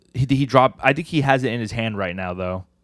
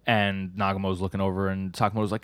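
normal talking voice

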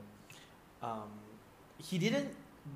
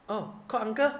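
put-on higher, louder parent-to-toddler voice; lightly acted mother's cue to greet someone; mother prompting her child; 9 semitones higher, 8 dB louder than usual. Young lady tells her daughter to greet him, prompting brightly.

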